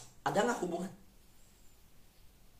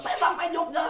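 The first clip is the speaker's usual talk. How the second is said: retold yell; 10 semitones higher than usual. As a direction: imitate a boy calling out his arrival. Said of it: "Dia teriak kenceng".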